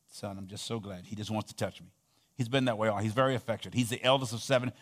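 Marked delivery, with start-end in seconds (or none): 0.1-1.1 tender hush. Retold or imitated. Tender memory of father.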